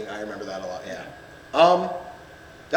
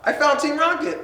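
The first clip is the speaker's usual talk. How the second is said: much higher, playful character voice; squeaky cartoon voice; 12 semitones higher, slower than usual. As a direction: mimic a cheery cartoon sidekick. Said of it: "they're like".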